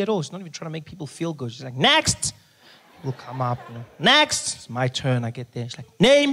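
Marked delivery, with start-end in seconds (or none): 1.7-2.3 high loud curt call; a brusque receptionist calling the next patient. Retold or imitated. Unfriendly nurse calling out. 4.0-4.5 high loud curt call; a brusque receptionist calling the next patient. Retold or imitated. Unfriendly nurse calling out. 6.0-6.3 high, loud clipped question; brusque receptionist asking for a name; curt demand. Retold or imitated.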